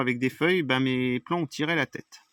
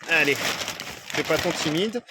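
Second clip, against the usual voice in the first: gentle coaxing; slower than usual. Real, not acted. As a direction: coax it out gently, slowly. Coaxing a shy animal aside. (encouragement douce et lente)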